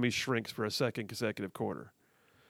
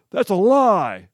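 loud, higher snap of a denial; mock accusation; 5 semitones higher, 10 dB louder, slower than usual. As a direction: call it a lie with full force. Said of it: "CNN would have said".

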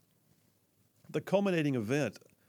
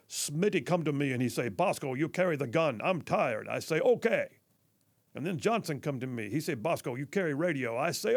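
put-on Bosco's Malawian accent. Soldier from Malawi explaining his heavy load, imitated Malawian accent. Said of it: "in his awesome accent".

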